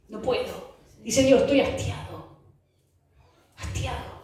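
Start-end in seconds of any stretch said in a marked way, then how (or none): 0.1-0.7 low, soft admission; weary refusal. Acted. God unable to stomach the offerings, weary and soft. 1.4-4.2 hushed, breath-heavy declaration; weary disgust. Acted. God telling the people He is fed up, hushed and weary. Acts God sick of offerings.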